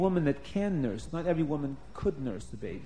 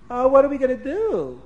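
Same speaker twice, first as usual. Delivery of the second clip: worried cry; 7 semitones higher, 8 dB louder than usual. Acted. Servants fail, baby keeps crying.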